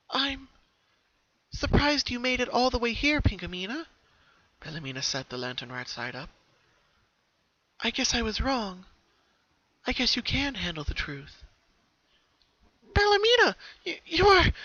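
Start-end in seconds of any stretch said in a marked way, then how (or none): 0.1-3.8 higher little-sister voice; ghostly younger sister. A narrator's reading. 7.8-11.4 higher little-sister voice; ghostly younger sister. A narrator's reading. 12.9-14.5 high, loud stammering Pinky; loud high shocked stammer; startled. A narrator's reading.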